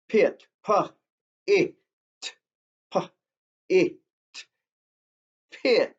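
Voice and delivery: "Pit" broken into "pa", "it", then blended back into "pit"; sounding out a word. Shown as a demonstration. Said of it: "let's do these words together".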